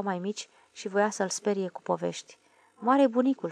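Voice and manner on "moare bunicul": plain reading voice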